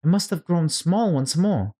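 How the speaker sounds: a hint of a little girl fretting to herself; Alice thinking aloud. A narrator's reading. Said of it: "she thought".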